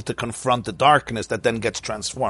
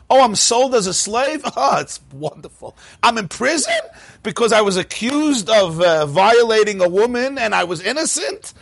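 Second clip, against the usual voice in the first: sarcastic fake positivity, pitched way up; mock cheerful Yosef; 7 semitones higher than usual. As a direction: overdo the delight like it's all wonderful.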